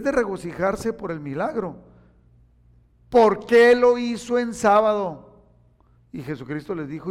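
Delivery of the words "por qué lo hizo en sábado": loud, slow outraged question; indignant complaint. Retold or imitated. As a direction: protest like a scandalised pharisee. Pharisees indignant at healing.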